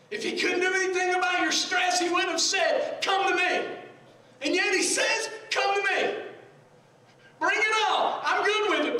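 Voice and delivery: high, loud pulpit shout; fervent shouted preaching; full-force preaching. Real, not acted.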